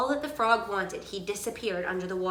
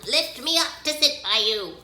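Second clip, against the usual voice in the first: higher-pitched demanding frog; crying frog; 4 semitones higher, 4 dB louder than usual. A narrator's reading. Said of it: "he stopped and cried".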